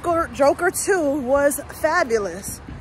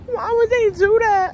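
a tearful, higher plea; real crying, raised pitch; 8 semitones higher, 3 dB louder than usual. Real, not acted. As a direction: ask it through real tears. Crying over the movie ending.

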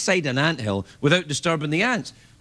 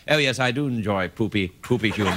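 put-on a lightly posh English accent; 8 semitones lower than usual. Showing how a British accent makes poop jokes classy, English accent. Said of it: "an English accent".

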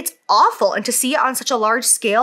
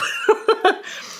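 breathy laugh; 12 semitones higher than usual. Real, not acted. Laughing at herself mid-story.

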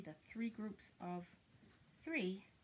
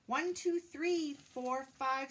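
excited counting; 4 semitones higher, 7 dB louder, slower than usual. Acted. Excited counting in read-aloud.

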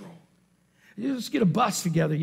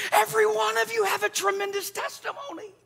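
high-pitched, sustained insistence; insistent declaration; 12 semitones higher than usual. Real, not acted. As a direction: press it on the crowd in a high voice. Emphatic insistence to congregation.

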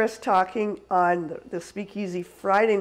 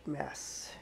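hushed correction; 8 semitones lower, 19 dB quieter than usual. Real, not acted. Catching herself before swearing.

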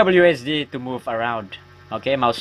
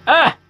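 short, high, loud yelp; startled yelp; 8 dB louder than usual. Real, not acted. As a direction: cry out sharply, high and loud. Startled while fearing a jump scare.

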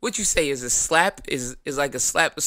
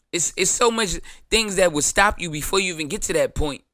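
usual speaking voice

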